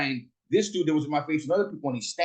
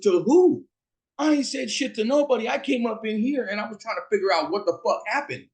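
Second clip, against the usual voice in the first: heated retort; 7 semitones higher than usual. Retold or imitated. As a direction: push back hard, voice up high. Heated argument with security outside.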